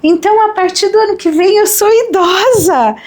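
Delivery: retold surprise. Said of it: "Aí eu parei e falei assim".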